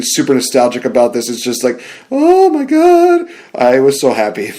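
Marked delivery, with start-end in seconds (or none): 2.1-3.3 very high, slow squeal of delight; ecstatic. Real, not acted. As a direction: let it out like pure joy. Gushing about the reunion.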